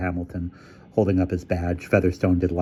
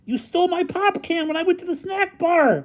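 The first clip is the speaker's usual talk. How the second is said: very high-pitched accusation; indignant squeal of complaint; heated insistence. Acted. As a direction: insist on your petty grievance. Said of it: "Featherstone insisted".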